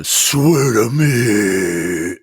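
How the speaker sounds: hammed-up slow gravel-voiced vigilante. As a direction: grind out the words like Bale's Batman.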